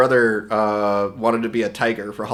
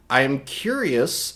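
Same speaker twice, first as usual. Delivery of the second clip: ordinary talk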